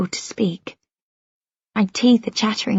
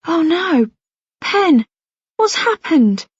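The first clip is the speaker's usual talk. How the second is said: worried dad; 7 semitones higher, slower than usual. Acted. Father sees daughter crying.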